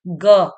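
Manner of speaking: ग said loud and clear so the a sound rings out; demo sound ga. Shown as a demonstration. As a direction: say ग with the short a heard at the end. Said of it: "घर पे थोड़ा बोलने की कोशिश कीजिए".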